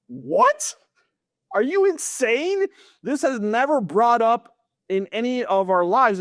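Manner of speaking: high incredulous outcry, retold; shocked disbelief. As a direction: pitch leaps way up in stunned disbelief. Shocked reaction to mom's answer.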